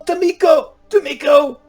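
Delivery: very high, loud cries of pain; screaming woman. Acted. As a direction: scream in agony for help. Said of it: "the screaming voice of a woman".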